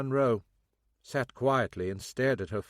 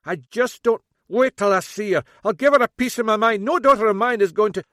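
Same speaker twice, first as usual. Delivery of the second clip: loud, high, fast outburst; fuming dad working himself up; rising furious rant; angry father; 11 semitones higher, 11 dB louder than usual. A narrator's reading. Mackenzie angry at daughter.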